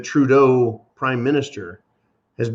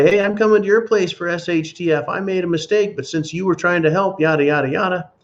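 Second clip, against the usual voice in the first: higher, lightly voiced pushy neighbor; imitating an unprepared neighbor who now wants help; mock neighbor; 6 semitones higher than usual. Neighbor knocking on the prepper's door, higher mock voice.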